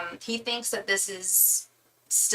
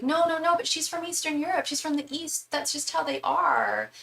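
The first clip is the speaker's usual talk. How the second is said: high, quick excited insistence; excited protest; 9 semitones higher than usual. Retold or imitated. Drag queens defending a contestant to the judges, high excited protest. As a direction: gush it out, defending her friend. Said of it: "all the girls were just like".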